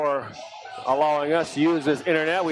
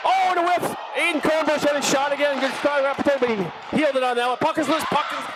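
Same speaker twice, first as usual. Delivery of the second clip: excited call; 10 semitones higher than usual. Real, not acted. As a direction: pitch jumps high, rapid, shouting the chance. Goalmouth chance in live commentary.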